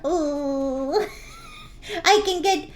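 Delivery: slime voice changer. Acted. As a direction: play the cheerful slime character through a voice changer. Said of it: "you're gonna hear my voice change".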